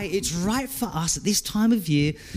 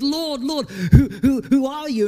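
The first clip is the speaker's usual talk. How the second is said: higher, louder, stammering alarm; startled Paul; 7 semitones higher, 4 dB louder than usual. Acted. Paul struck down on the Damascus road, alarmed. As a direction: cry out in shocked confusion.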